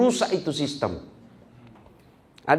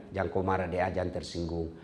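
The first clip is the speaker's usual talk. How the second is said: much softer, hushed coaxing; soothing; 9 semitones lower, 9 dB quieter than usual. Retold or imitated. Director softly calming an employee, handing him money. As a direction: quietly coax the worker with cash. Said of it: "dia bilang".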